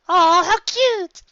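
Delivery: very high, drawn-out gushing; gushing coo. Acted. Lyra gushes over the Kraken hugging everyone, high and slow. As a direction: melt over how cute it is. Cooing at the hug.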